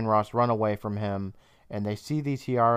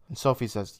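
ordinary talk

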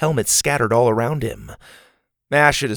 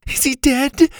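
put-on frightened girl; 4 dB louder than usual.

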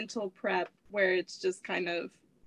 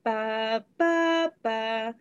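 tongue trill with melody; much slower than usual. Shown as a demonstration. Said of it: "You're gonna go".

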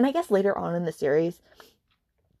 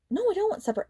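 much higher, squeaky little voice; high squeaky quote; 14 semitones higher, much faster than usual. Retold or imitated. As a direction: squeak it out meekly. Her quoted reply, high.

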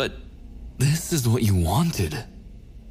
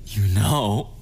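ordinary delivery

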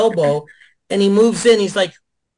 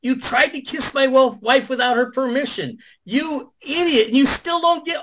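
higher-pitched, exasperated retold tirade; ripping into him; 9 semitones higher than usual. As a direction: tear into him, voice climbing higher. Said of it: "I was ripping his ass".

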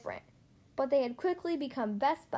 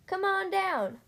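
high-pitched call to a friend below; crying out; 6 semitones higher, slower than usual. A narrator's reading. Mater beckons Lightning into the gravel pit, crying out. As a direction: call out eagerly in a raised pitch. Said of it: "cried Mater".

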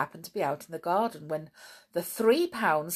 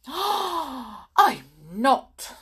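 loud, high indignant cry; affronted denial; 7 semitones higher, 7 dB louder than usual. A narrator's reading. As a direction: protest as if deeply insulted. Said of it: "cried Snarl indignantly".

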